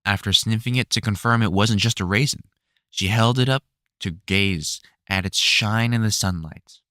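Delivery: ordinary delivery, nothing marked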